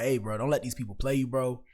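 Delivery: usual speaking voice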